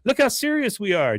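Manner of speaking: put-on high, chirpy girl voice; Luann voice. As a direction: say it brightly and proudly.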